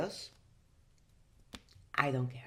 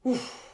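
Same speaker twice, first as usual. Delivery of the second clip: high surprised oof; 11 semitones higher, 5 dB louder than usual. Real, not acted. Reacting while applying powder.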